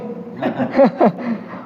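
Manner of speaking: quiet high laugh; soft laugh. Real, not acted. Teacher laughs about the class falling behind, softly. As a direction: let a small laugh slip out.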